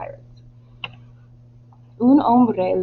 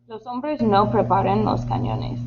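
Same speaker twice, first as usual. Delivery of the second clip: ordinary delivery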